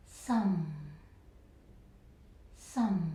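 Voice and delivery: some falling low. Shown as a demonstration. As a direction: let some drop. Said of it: "The fourth tone is some".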